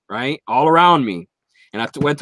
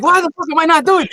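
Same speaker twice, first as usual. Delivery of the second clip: high, fast excited rush; 5 dB louder, faster than usual. Retold or imitated. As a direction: blurt it out, all geeked out. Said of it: "And I was like".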